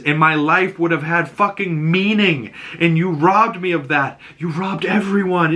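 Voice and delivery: high, emphatic mock-upset voice; imitated tearful accusation. Ellie tells Joel he robbed her, upset. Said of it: "Ellie's breaking down in tears".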